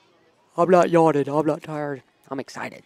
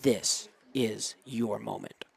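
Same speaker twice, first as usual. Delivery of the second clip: low, slow, soft announcer; hushed dramatic build-up; 5 semitones lower, 11 dB quieter, much slower than usual. Real, not acted. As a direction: let each word hang, low and soft. Builds tension for batter.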